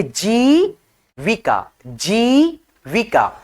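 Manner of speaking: जीविका with the long ई in जी drawn out slowly; जीविका spoken with a long, stretched ई. Shown as a demonstration. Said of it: "खींच करके है".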